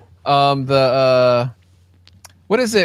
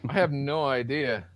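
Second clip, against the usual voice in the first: ordinary talk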